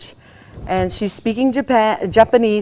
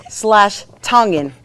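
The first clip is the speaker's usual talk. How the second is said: ordinary delivery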